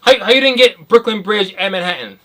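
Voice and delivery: high, loud play-acted exasperation; hammed-up disbelief; mock incredulous. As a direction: demand it as if it were obvious. Acts date blaming the listener.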